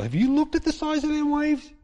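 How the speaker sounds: high, incredulous objection. Retold or imitated. Disciple objecting to Jesus, high and incredulous. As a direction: ask in disbelief about the waves.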